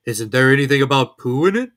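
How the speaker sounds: a cosy storybook bear, proud; Pooh voice. A narrator's reading.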